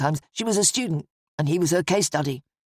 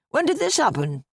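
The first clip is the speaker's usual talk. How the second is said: higher-pitched, taken-aback question; protesting; 8 semitones higher than usual. A narrator's reading. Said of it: "he protested".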